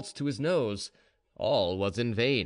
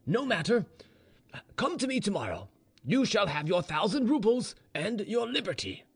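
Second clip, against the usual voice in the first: higher-pitched, declamatory officer's voice; authoritative general; 6 semitones higher than usual. Acted. General grants Gregory his reward, authoritative and formal. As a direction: pronounce a master's decision with formality.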